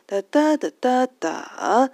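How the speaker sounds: "哒" beats high and loud, the last swept upward; rising ending. Shown as a demonstration. Teacher shows the rhythm in syllables, rising ending. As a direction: beat out "哒" and lift the final one high. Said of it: "结尾往上扬的".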